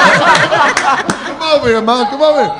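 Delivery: laughing call. Real, not acted. Son calling his mom over, high and laughing. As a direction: wave her over through the laughter.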